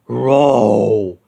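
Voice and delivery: low, growled roar; angry monster roar. A narrator's reading. Marsh monster roaring at Shaggy, angry low growl. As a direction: snarl at the pests. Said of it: "The angry marsh monster".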